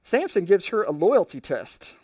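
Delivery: ordinary talk, nothing marked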